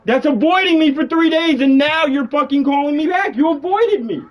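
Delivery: loud, furious demands; shouting at a friend who dodged his calls; angry shouting. Real, not acted. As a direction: yell at him for ignoring calls. Angry call about unreturned calls.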